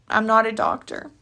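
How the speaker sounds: usual speaking voice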